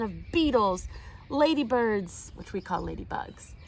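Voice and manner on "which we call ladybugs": a quick whisper to the listener; whispered aside. Real, not acted. Narrator explaining ladybirds to children, whispered. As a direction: share it like a little secret.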